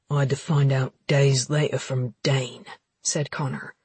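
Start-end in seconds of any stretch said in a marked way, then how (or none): 0.1-2.6 deep, cold male voice; hard, accusing low voice; low brooding man. Acted. Connor voiced in dialogue.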